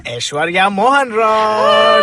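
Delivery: drawn-out hyped introduction of the guest; grand announcement. Acted. Host introduces the guest with a slow hyped build. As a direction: announce her like a star.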